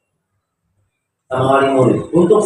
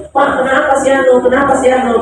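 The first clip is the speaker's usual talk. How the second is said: higher, worried calling out; alarmed neighbour; 7 semitones higher than usual. Retold or imitated. Neighbours crowding the teacher, alarmed questions. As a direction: ask urgently what happened.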